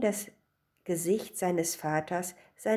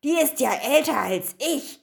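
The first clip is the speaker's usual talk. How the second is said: high, loud indignation; raised-voice scandalised mother; shouting; 6 semitones higher, 5 dB louder than usual. A narrator's reading. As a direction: exclaim it in outrage. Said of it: "schrie sie".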